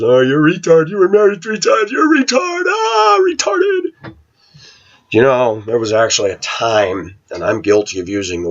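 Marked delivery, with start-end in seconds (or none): 0.0-1.9 high, nasal-free sneering taunt in a put-on voice; mocking commenter voice. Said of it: "I get laughed at". 1.9-4.1 very high, shouted jeering in a put-on voice; shrieking mock taunt. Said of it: "I get laughed at".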